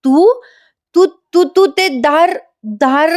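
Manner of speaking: high-pitched, stammering wife act; imitated faltering speech with pauses; halting accusation. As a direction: stutter out fragments as if just remembering. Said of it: "बोलतं करायचा प्रयत्न करते".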